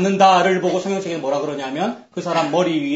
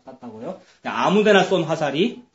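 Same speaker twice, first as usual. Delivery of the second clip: ordinary talk, nothing marked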